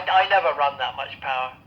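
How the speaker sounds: usual speaking voice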